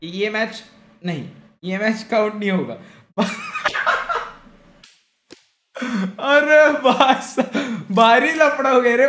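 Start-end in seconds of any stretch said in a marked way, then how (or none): 3.2-4.3 very high, squealing burst of laughter; helpless laugh. Real, not acted. Laughs at losing first match. 6.2-9.1 drawn-out exclamation through laughter; laughing dismay. Real, not acted. Laughing over the disastrous first match.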